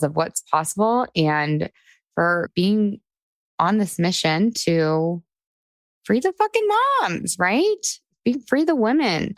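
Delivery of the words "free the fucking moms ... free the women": much higher, exclaimed with gusto; rallying cry. Real, not acted. Podcast host rallying her listeners, excited and high. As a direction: fire up the listeners.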